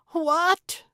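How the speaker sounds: high, loud shocked screech. A narrator's reading. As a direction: shriek it in disbelief. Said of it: "Gertrude screeched".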